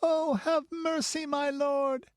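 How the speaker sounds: very high, anguished begging; high, desperate child's wail; child's screamed plea. A narrator's reading. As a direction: cry for mercy in pain and terror. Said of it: "screamed the child".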